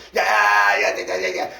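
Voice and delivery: cheering shout. Retold or imitated. Cheering like fans.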